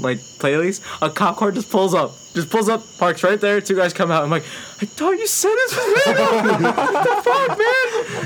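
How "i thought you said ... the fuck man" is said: very high squeal, breaking into laughter; mock panic. Stoned tourist to friends as cops pull up, mock panic. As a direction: squeal it in hammed-up alarm.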